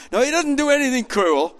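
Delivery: ordinary delivery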